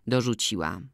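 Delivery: usual speaking voice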